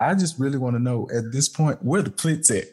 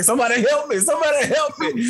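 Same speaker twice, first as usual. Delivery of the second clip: high desperate cry for help; terrified horror victim; faster than usual. Retold or imitated. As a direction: wail it like a preacher pleading. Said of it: "What Jackie McCullough say?".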